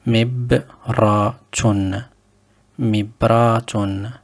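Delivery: mibratun, drawn out slowly and clearly; slow demo word. Shown as a demonstration. Teacher models a new Arabic word slowly. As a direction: sound out mibratun at a slow pace. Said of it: "Le deuxième".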